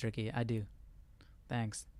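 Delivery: ordinary talk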